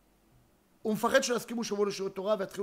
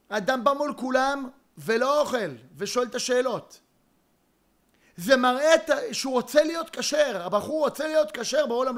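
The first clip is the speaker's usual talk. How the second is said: fervent preaching; 4 semitones higher, 4 dB louder than usual. Real, not acted. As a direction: preach it out to the crowd with fire. Preaching at full force. (דרשה נלהבת, גבוהה ורמה)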